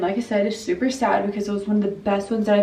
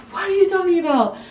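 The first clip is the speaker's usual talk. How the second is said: high, quick imitated disbelief; mock bafflement; 9 semitones higher, faster than usual. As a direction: imitate a baffled mom, pitched way up. Mom's baffled reply to sobbing daughter.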